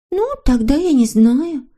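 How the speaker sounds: high, slow, bewildered little voice; at a loss. A narrator's reading. Snork Maiden out of suggestions for Hemulen, at a loss. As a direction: give up helplessly, high and drawn out. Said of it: "растерялась Норочка".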